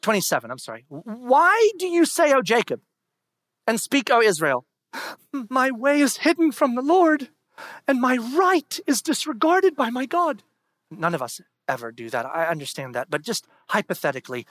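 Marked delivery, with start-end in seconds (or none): 0.9-10.4 loud, higher scripture proclamation; preaching at full force. Acted. Pastor proclaiming Isaiah to congregation, full-force preaching. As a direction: thunder the verse out.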